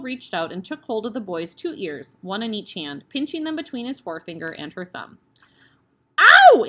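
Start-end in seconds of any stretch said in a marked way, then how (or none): 6.2-6.7 a piercing, very loud high yelp; cry of pain. Acted. Boy cries out as the headmistress pinches his ears. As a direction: yelp as your ear is pinched. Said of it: "Eric cried".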